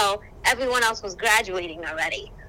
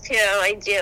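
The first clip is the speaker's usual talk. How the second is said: ordinary talk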